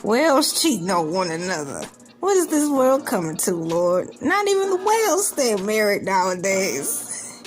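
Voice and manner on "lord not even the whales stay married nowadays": ordinary talk, nothing marked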